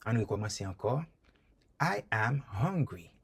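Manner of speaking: ordinary delivery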